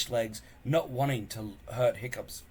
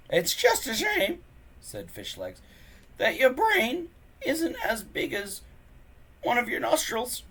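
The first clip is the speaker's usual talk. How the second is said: much higher voiced dry jibe; nerdy sidekick's jibe; 13 semitones higher, 5 dB louder than usual. Nervous friend mocking the bully, high voiced. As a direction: jab at the bully's brain.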